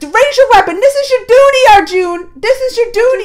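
Krishna loses patience with Arjun, angry shout. Retold or imitated. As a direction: bark it at him like a furious god. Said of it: "He starts getting mad".

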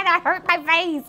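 high mock-crying protest; wailing baby doll. Doll protests the towel on its face, high fake crying. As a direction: blubber like a pretend upset baby.

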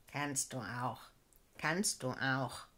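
low, slow growling beetle; low gruff beetle answering the girl; growled beetle voice. A narrator's reading. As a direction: rumble it like a gruff old beetle. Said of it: "knurrte Goldkäfer".

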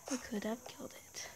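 breathy unvoiced whisper. Real, not acted. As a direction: whisper it to yourself. Repeats line as a whisper.

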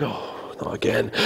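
low, soft, weary sigh of words; mock long-suffering groan; weary dismay. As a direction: mutter it, resigned. Jesus sees the severed ear.

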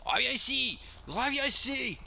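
high-pitched angry yell; sharp, high shout of pursuit; calling after someone. Pretend. Yells after a fleeing driver.

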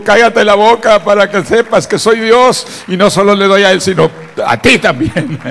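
loud, high declaration as God; imitated divine command, shouted; God's rebuke. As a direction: thunder the Lord's answer at full volume. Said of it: "el Señor me dijo".

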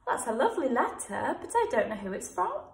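lightly voiced puzzled teacher; a hint of a confused reader; imagined teacher. Teacher voicing Miss King reading an unsigned letter, light. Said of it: "she might think".

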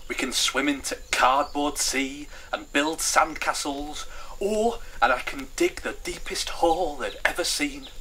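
eager, excited character voice. Acted. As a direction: list the plans with growing eagerness. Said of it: "she said eagerly".

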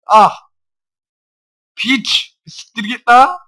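very high, loud insult; furious shout. Real, not acted. Gamer screaming at a Zubat, furious shout. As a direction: scream it at the screen.

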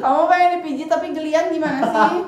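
fed up and laughing; exasperated. Real, not acted. Annoyed at ticklish friend. (kesal sambil heran)

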